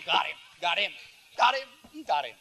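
laughing, high-pitched repeated crowing; gleeful mock gloating. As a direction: chant it in mock triumph through laughter. Mimics celebrating the attack, laughing.

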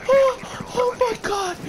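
very high shrieking laugh; shrieking laughter. Real, not acted. Wild reaction in game.

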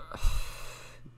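heavy breathy exhale; long sigh. Real, not acted. Player unsure what to type next, long sigh. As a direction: let out a long wordless sigh. Tense moment in the game.

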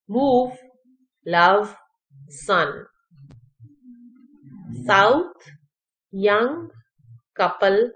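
isolated words with pauses, short oo in "पुट", long in "रूल"; careful list reading, each vowel distinct in "ग्रुप" and "कुड"; model word reading. Shown as a demonstration. Teacher rereading tricky test words for learners, careful model pronunciation. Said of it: "पढ़ देती हूँ".

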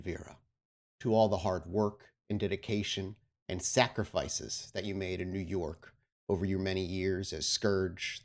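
normal talking voice

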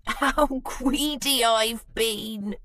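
slow, giggly admission; spluttering giggle. Acted. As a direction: giggle it out, sheepish. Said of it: "spluttered Bruce with a giggle".

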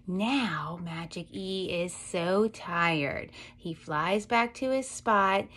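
ordinary talk

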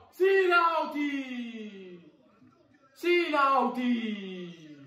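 slow, high stretched cheer; drawn-out cheer. Real, not acted. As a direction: stretch each word in joy.

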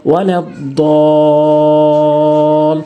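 "ওয়ালাদ-দল" with "দ" held very long; চার আলিফ টান. Shown as a demonstration. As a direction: stretch the vowel of "দল" in "ওয়ালাদ-দল", then close on l. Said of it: "চার আলিফ টেনে পড়বেন". ("ওয়ালাদ-দল" চার আলিফ টেনে)

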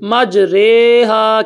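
مج رے ہا, ر with a leaning e vowel, drawn out slowly; slow recited مج رے ہا, رے not ری; imala on re. Shown as a demonstration. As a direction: stretch مج رے ہا with رے like the e in re. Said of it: "اس کو آپ نے امالہ کرنا ہے".

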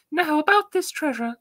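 high squeaky elf voice. Acted. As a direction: pitch it way up like a little elf. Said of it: "like, elf, elf voice".

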